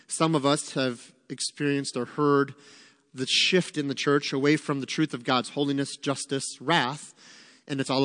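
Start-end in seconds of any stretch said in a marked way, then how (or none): none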